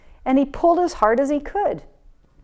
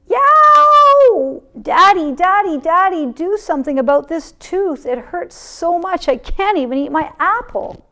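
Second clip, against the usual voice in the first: high, loud painful yelp; yelled; 8 semitones higher, 8 dB louder than usual. A narrator's reading. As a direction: shout for Daddy over the sore tooth. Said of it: "and yelled".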